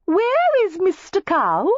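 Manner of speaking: very high, slow sing-song call; coy calling out. A narrator's reading. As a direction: tease him by calling out sweetly. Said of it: "heard Cassandra Layton's voice".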